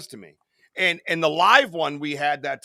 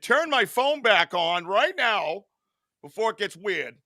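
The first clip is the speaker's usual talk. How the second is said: mock stern demand; 7 semitones higher, slower than usual. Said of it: "So I said".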